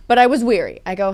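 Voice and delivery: ordinary talk, nothing marked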